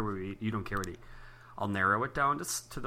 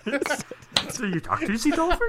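high teasing voice; 14 semitones higher, slower than usual. Acted. Teasing about dolphin voice.

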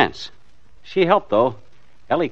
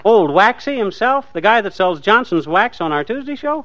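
surprised; 10 semitones higher, 4 dB louder than usual. Acted. McGee surprised, recognizing Wilcox.

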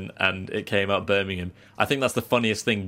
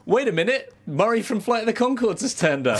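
high-pitched put-on character voice; 11 semitones higher than usual. Interrupting his own anecdote with a surprise guest, silly character impression. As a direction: pipe up in a silly impression, much higher. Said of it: "involving [name] and Kermit the Frog".

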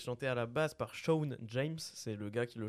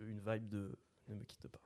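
ordinary talk

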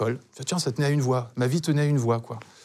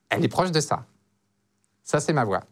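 demonstrating his unaltered, more nasal natural voice; real voice. Shown as a demonstration. Man revealing his real voice to a sceptical friend, nasal natural voice. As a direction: drop the trained voice, go slightly nasal. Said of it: "ma vraie voix".

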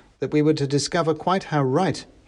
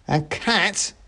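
mock mouse shout; 7 semitones higher than usual. A narrator's reading. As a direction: exclaim it as the little soldier. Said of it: "shouted the first mouse".

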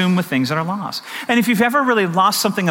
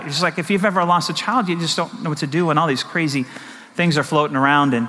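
normal talking voice

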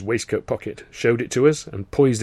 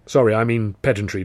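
ordinary delivery

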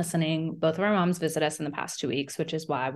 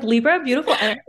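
higher and louder, proud; playful boast; gushing; 7 semitones higher, 7 dB louder, faster than usual. Retold or imitated. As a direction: brag about it out loud. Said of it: "I was like".

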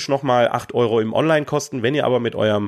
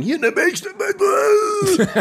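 shouting; much slower than usual. Retold or imitated. Imitating game show audience shouting.

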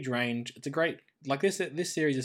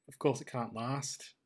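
normal talking voice

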